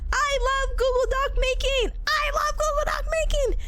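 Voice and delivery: high, sustained excited declaration; gushing enthusiasm. Pretend. Gushing repeat about doc making.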